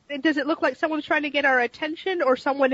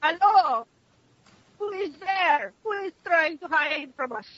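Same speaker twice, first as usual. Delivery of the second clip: put-on higher-pitched Russian-accented challenge; put-on Russian voice, raised in pitch; 5 semitones higher, slower than usual. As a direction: call out suspiciously with a Russian accent. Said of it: "a Russian-sounding voice yell out to you".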